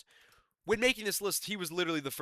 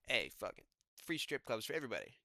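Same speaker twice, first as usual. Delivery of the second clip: terrible impression. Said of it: "such a terrible impre".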